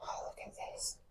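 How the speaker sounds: awed hushed whisper. Real, not acted. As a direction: whisper in wonder. Inside dark quiet crypt.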